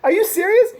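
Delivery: outraged "are you serious" squeal; incredulous disbelief. Retold or imitated. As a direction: scoff at the dork in disbelief. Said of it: "I'm like".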